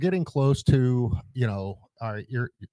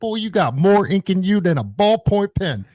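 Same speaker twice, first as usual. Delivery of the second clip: higher, louder mocking drill sergeant; 9 semitones higher, 6 dB louder than usual. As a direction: bark it like a drill instructor sizing up a recruit. Drill sergeant mocking recruit's tattoos.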